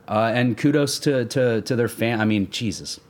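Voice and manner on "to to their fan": normal talking voice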